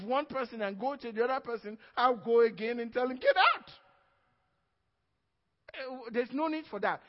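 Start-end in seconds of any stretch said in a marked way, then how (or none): 3.2-3.7 sharp, much higher and louder "Get out"; commanding shout. Retold or imitated. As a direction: bark the order at the demon. Preacher commands demon to leave.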